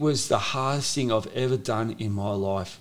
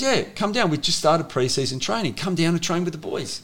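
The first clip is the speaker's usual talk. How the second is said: coach inviting; 7 semitones higher than usual. Retold or imitated. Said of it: "he goes".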